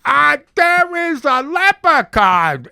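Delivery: high, slow, loud hammed-up line; bad actor mockery. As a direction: parody wooden acting. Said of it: "and it's like".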